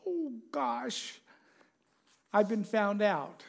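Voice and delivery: mild dismay. Acted. Woman caught touching Jesus' coat.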